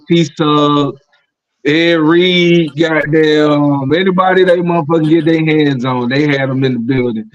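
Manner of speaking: ordinary talk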